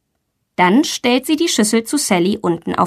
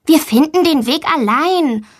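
put-on high eager little girl; a girl volunteering to her teacher, high and eager; child voice; 6 semitones higher than usual. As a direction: play the girl, bright and keen to help. Valentina promises teacher.

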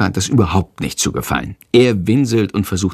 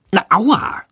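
yelp of pain; 10 semitones higher than usual. A narrator's reading. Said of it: "schreit Jendrik laut".

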